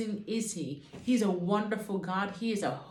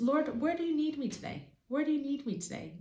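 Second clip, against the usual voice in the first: lightly pleading, higher-pitched prayer; prayerful asking; 6 semitones higher than usual. Shown as a demonstration. Believer asking the Lord where to serve, higher prayerful question.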